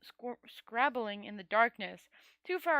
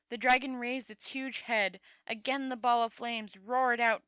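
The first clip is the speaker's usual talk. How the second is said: usual speaking voice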